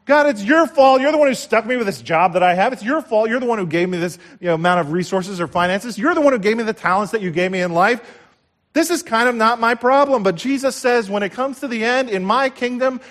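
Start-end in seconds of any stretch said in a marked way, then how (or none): 0.0-10.2 high, loud, complaining excuse to God; whiny excuse. Retold or imitated. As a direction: blame God like a sulking servant. Voicing a giver's excuse to God.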